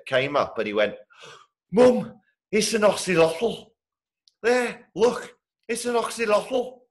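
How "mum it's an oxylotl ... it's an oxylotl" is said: put-on high-pitched little boy with a thick Liverpool accent; thick Liverpudlian accent. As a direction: imitate an excited Scouse child calling Mum, drawn out and high. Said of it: "little boy with a very thick Liverpudlian accent".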